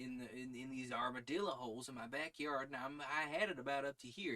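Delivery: quick Southern chatter; fast Southern accent. Shown as a demonstration. A Southerner complaining about armadillos, fast Southern accent. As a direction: rattle it off fast with a light Southern twang. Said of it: "Fast Southern accent makes me chuckle".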